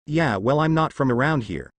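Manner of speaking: plain reading voice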